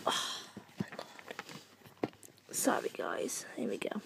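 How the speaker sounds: low soft whispered apology. Real, not acted. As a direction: hush it, sheepish. Apologising quietly to viewers.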